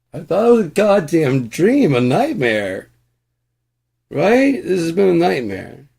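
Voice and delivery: high, loud outburst of relief; loud relieved rant. Acted. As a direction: shout out your relief. Ranting loudly about nightmare.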